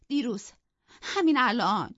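high, loud aggrieved answer; acted sobbing. A narrator's reading. Isabella answering her sister-in-law's challenge, mock-sobbing. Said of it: "ایزابلا حق حق کنان گفت".

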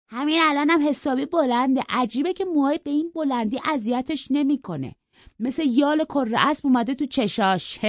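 high, thin little gentleman voice; a pampered boy making a snide remark; prissy young boy. Acted. Young Linton mocks Heathcliff's long hair, high and prim.

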